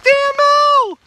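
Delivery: high, loud excited squeal; excited greeting. Retold or imitated. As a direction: squeal it like a delighted fan. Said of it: "She's like".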